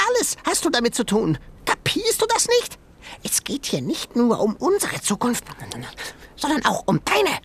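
very high, fast frantic animal voice; frantic high creature voice; high panicky creature. Acted. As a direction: squeal it in a panic, scolding the boy. Sengi creature voice.

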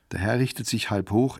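ordinary delivery

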